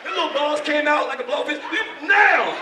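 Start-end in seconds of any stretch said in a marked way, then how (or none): 1.7-2.6 loud, high outburst; acted shouted order. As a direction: bellow it as the worked-up attacker. Repeats the attacker's shouted answer.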